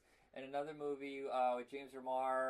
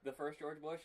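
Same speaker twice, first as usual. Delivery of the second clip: ordinary talk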